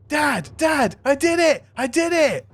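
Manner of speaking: put-on very high, eager kid voice; high-pitched triumphant cry; Zeed, excited child. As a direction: squeal proudly to a parent. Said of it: "and just goes".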